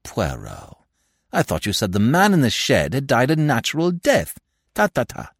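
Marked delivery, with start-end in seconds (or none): 4.7-5.3 higher impatient tut-tut; quick impatient tutting. Acted. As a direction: dismiss him with a tut. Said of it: "favourite ejaculation of impatience".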